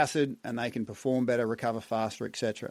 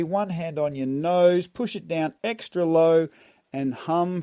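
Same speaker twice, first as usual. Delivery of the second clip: urgent, louder breath coaching cues; energized breathwork coaching; 4 semitones higher, 4 dB louder than usual. Shown as a demonstration. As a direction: drive the exhale with rhythmic calls. Third breathwork round cues.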